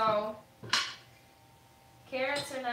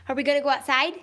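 talking to a dog; 5 semitones higher, 4 dB louder, faster than usual. Real, not acted. Coaxing dog to go outside.

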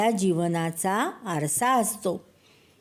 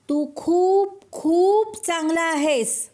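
much higher, slow, loud call into the hills; 8 semitones higher, slower than usual. A narrator's reading. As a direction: stretch each word out as you shout it high. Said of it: "ते मोठ्या आवाजात ओरडले".

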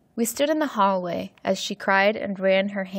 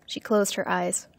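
ordinary delivery

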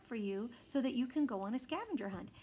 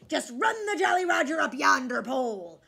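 louder pirate call; hearty pirate; 3 semitones higher, 8 dB louder than usual. A narrator's reading. Pirate captain offering to babysit again. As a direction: call it out warmly.

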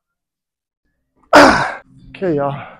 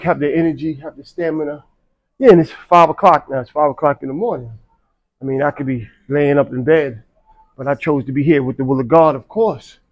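ordinary talk, nothing marked

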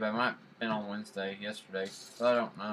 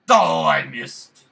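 frustrated yelp; 15 dB louder, much slower than usual. Real, not acted. Frustrated at missing jump.

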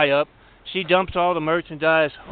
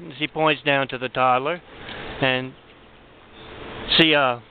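ordinary talk, nothing marked

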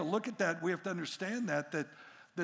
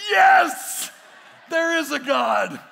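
high, very loud cry of relief; hammed-up triumphant yell; jubilant shout; 8 semitones higher, 15 dB louder, slower than usual. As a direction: shout it like a prayer answered. Father's exaggerated relief at the answer.

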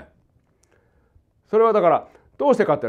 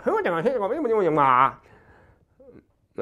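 mock Chinese; 5 semitones higher, much faster than usual. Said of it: "中国の三歳の子供は".

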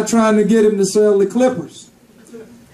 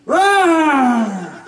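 lion roar. Acted. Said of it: "And he would be like".

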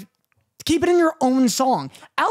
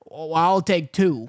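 slow, lower mock Western accent; 6 semitones lower, slower than usual. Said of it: "than doing a Western accent".

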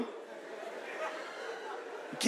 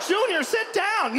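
scolding parent; 11 semitones higher, slower than usual. Acted. Parent ordering the crazed kid.

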